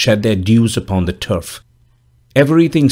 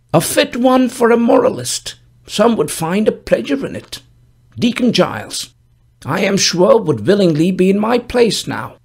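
put-on old gravedigger voice; 10 semitones higher than usual. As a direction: speak as a dour old gravedigger, raised pitch. Gravedigger speaks in story.

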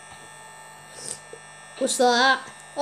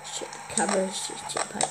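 whispering; 6 semitones lower, 12 dB quieter, faster than usual. Real, not acted. Muttered aside.

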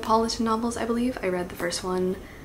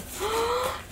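gasp; 5 dB quieter than usual. Real, not acted. Sees wrapping.

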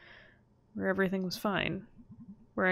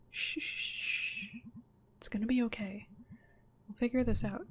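hushing whisper; 3 semitones higher, 3 dB quieter than usual. Acted. Comforting a scared friend, hushing.

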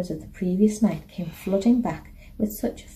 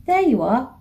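wailing; 4 semitones higher, 4 dB louder than usual. A narrator's reading. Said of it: "wailed Anna".

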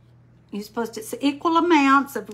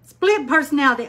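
laughing, louder and quicker; 4 semitones higher, 6 dB louder, faster than usual. Real, not acted. Laughs while naming it.

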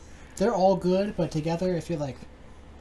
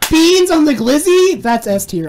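excited; 11 semitones higher, 18 dB louder, slower than usual. Real, not acted. Thrilled by beans on hot dog.

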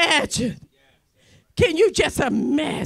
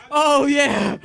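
ordinary delivery

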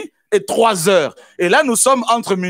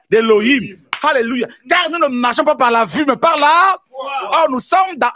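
full-force preaching; 6 semitones higher than usual. Acted. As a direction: preach it out, rousing the church.

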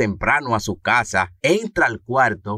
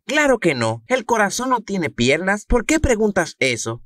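mom voice; 10 semitones higher than usual. Acted. Mamá responde al niño.

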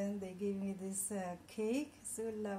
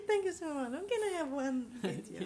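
half laughing, half crying; giggling while the tears come; laughing through tears. Real, not acted. Asking her employers for a video, laughing through tears. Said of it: "Don't cry".

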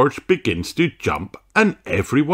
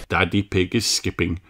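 ordinary delivery

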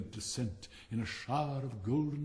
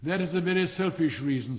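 loud, raised, indignant retort; angry; 4 semitones higher, 7 dB louder than usual. A narrator's reading. Rocket scolding the happy cracker, angry. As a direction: snap back in offended anger. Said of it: "said the rocket angrily".

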